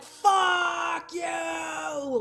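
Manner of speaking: high, drawn-out furious cry; cathartic scream. Shown as a demonstration. Reader showing a client how to let it out, cathartic scream. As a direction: scream it out, stretching both words. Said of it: "letting yourself scream from that inner place".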